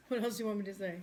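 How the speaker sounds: normal talking voice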